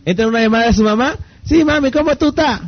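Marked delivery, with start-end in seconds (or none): 1.4-2.6 put-on high, lilting Venezuelan accent with mom; Venezuelan phone voice. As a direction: switch into Venezuelan Spanish for mami. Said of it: "y yo hago lo mismo, hablo con venezolanos".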